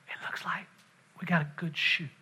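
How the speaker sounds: very soft, hushed quote; imitating a hushed instructor; retold whisper. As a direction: drop it right down as if into someone's ear. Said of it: "he whispers in my ear".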